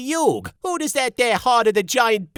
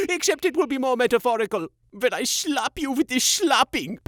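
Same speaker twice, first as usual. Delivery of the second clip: haughty German villain; 5 semitones higher than usual. Acted. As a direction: gloat in a pompous accent. Siegfried villain monologue.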